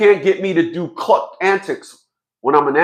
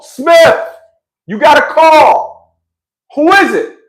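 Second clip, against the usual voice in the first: calling out; 9 semitones higher, 7 dB louder, slower than usual. Retold or imitated. Calling out across base.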